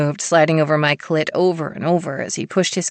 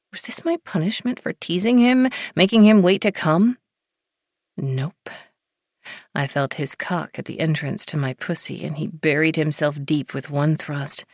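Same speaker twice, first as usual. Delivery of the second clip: ordinary delivery, nothing marked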